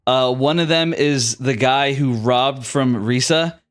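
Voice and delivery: ordinary talk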